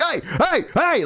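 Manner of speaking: high yelps like a little dog; mock barking. Roommate barking back as a joke, high doggy yelps. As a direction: bark the word in short, high bursts. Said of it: "like, bark".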